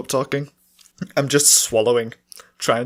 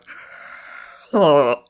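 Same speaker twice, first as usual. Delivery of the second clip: loud strained squeal, falling; failed high attempt; 3 semitones higher, 4 dB louder than usual. Shown as a demonstration. Failing at the silly voice, strained cry. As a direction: try for the high voice and crack. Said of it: "I tried to do that and I couldn't".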